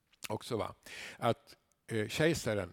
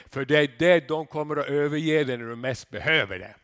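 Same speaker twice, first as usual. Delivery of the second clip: ordinary delivery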